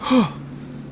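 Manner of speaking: long unvoiced exhale of relief; relieved sigh after a close call; breathy sigh of relief. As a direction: let the fear drain out in one breath. Rescued soldier catching breath.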